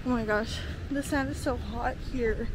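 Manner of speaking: ordinary delivery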